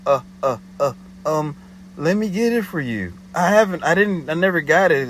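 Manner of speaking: imitating the flustered caller making excuses; panicky excuses. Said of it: "more panicky".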